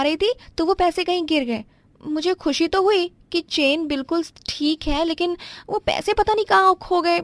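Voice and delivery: pitched well up, flustered daughter-in-law apologizing; young daughter-in-law. Acted. Wife excuses lost money.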